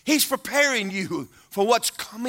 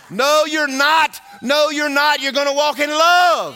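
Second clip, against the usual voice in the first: God's firm shout; 9 semitones higher, 9 dB louder than usual. Acted. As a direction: shout it down, no arguing. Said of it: "And God's going".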